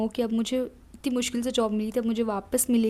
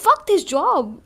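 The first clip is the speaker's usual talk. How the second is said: loud, higher fed-up fling; defiant outburst; 7 semitones higher, 8 dB louder, slower than usual. Retold or imitated. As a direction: spit it out like you're done with it. (तेज़ आवाज़ में बेबाक गुस्सा)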